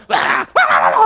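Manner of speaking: loud, high-pitched yapping; imitated dog barking. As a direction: bark like a frantic little dog. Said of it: "개새끼 어떻게 해요?". (강아지가 요란하게 짖는 흉내)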